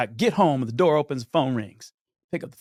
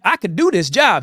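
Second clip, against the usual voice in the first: louder, higher protest; indignant protest; 7 semitones higher, 7 dB louder than usual. Retold or imitated. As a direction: push back, offended. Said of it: "I'm like".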